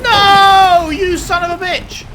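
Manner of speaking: high, loud raging yell; furious gamer scream. Real, not acted. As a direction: scream at the game in fury. Killed by machine in game.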